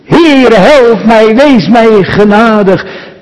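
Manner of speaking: preacher voicing David's loud plea for mercy; cry for help. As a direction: call out to the Lord in distress. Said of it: "ik riep luidkeels tot de Heere".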